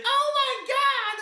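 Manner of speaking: high piercing shriek of disgust; shocked shriek. Retold or imitated. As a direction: shriek it like a kid who sees a fly. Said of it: "everyone's like".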